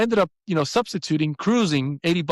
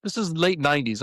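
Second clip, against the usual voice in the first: ordinary talk, nothing marked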